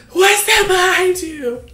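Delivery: very high, drawn-out shriek; imitated panicked screech; bloody-murder scream. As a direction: scream it like a terrified mom, loud and stretched. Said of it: "She screams bloody murder".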